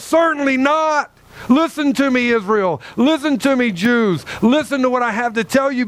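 high-pitched emphatic pleading; impassioned, urgent. Shown as a demonstration. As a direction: plead with them to listen, fully invested. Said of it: "Man, Paul's in this".

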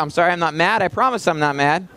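ordinary talk

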